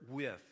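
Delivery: "with" set apart and said with a falling tune; preacher singles out "with" before explaining it; stress on with. Shown as a demonstration. Pastor to his congregation, lingering on "with". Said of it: "But I want to emphasize".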